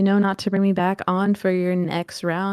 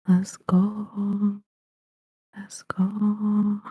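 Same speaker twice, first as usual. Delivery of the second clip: hushed unvoiced whisper, building up; whispered hype; much slower than usual. Guest whispering herself up before her intro, unvoiced. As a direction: whisper it like a secret countdown.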